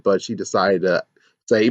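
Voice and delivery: ordinary talk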